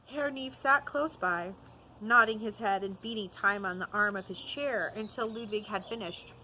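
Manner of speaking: usual speaking voice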